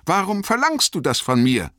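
high voice, sharp with suspicion; Bilbo, scharf, argwöhnisch. A narrator's reading. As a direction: challenge him sharply in a high voice. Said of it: "Sie klang scharf vor Ärger und Argwohn". (scharf vor Ärger und Argwohn)

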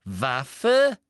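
loud, rising, astonished echo; excited. A narrator's reading. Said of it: "vor Aufregung aus den Höhlen".

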